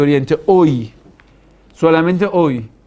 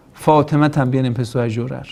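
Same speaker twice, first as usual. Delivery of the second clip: usual speaking voice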